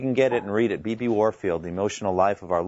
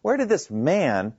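slow, higher question with "man" stretched out; stress on "man"; 5 semitones higher, slower than usual. Acted. Townspeople doubting the hometown teacher, heavy stress on "man". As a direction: land heavily on a drawn-out "man". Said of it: "stress the word man".

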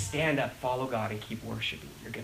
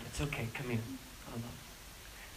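comforting; 8 semitones lower, 18 dB quieter than usual. Retold or imitated. Parent comforting a hurt child, tender and hushed. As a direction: soothe a child close up.

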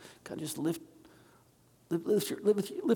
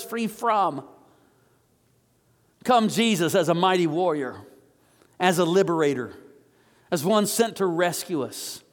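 fervent prayer; 2 semitones higher than usual. Acted. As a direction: call on him like a rescuer. Leads the congregation in prayer.

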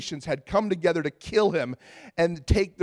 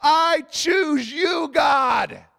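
high, drawn-out joyful shout; 8 semitones higher, much slower than usual. Retold or imitated. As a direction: shout it to God at the top of your lungs. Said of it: "shouting and singing and yelling at the top of my lungs".

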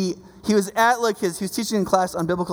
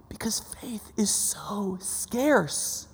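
slow whispered emphasis; hushed, slow confiding whisper; 9 dB quieter, much slower than usual. Real, not acted. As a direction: drop to a whisper to draw them in. Sermon point, sudden hush.

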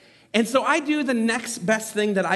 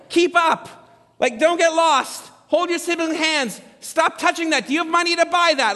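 put-on higher, louder parent barking orders at kids; retold parent yelling; 7 semitones higher, 4 dB louder than usual. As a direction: yell at rowdy kids in a crowded mall. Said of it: "I yell at them".

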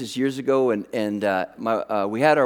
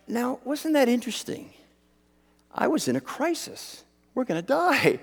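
musing; 6 semitones higher, 5 dB quieter than usual. Retold or imitated. Muses afterwards on his reaction.

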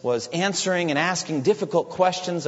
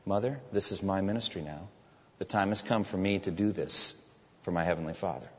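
gentle; 7 semitones lower, 8 dB quieter than usual. Retold or imitated. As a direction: lower and softer, very gentle. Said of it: "in a very gentle way".

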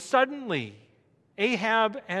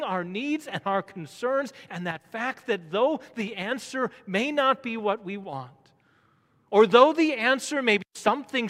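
louder, driving pulpit delivery; fervent preaching; 5 semitones higher, 9 dB louder than usual. Real, not acted. Preacher urging trust in unseen answers to prayer, fervent preaching. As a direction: press it home with rising force.

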